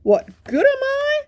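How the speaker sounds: very high, pondering puppy voice; the dog asking himself what he can do; wondering Spot. Acted. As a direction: muse aloud in a squeaky high voice.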